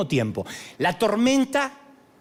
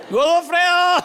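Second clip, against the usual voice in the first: calling out; 11 semitones higher, 6 dB louder, slower than usual. Real, not acted. Calling out a name.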